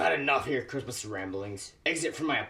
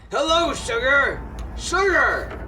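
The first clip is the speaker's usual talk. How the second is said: loud, much higher call across the room; 10 semitones higher, 5 dB louder, slower than usual. Acted. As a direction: call out to your lost brother, desperate to be heard. Calling to his brother.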